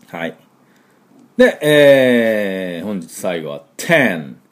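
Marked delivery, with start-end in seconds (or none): none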